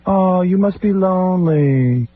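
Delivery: high, slow, syrupy sympathy; mock sister. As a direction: stretch it out in a sickly sweet pity voice. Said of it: "my sister walked in".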